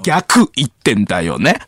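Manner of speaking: slow, loud theatrical reveal; 関明夫 impression's punchline voice. Teller springing his twist on the partner, punchline voice. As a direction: hit it like the big twist, ending on a rise. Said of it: "この決めの時なんか音声変わる".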